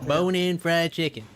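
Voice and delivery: mocking echo. Parroting the traveler's chicken expectation, mocking. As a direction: mimic him in a silly voice.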